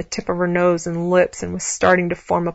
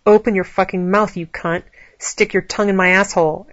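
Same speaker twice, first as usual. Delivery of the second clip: ordinary delivery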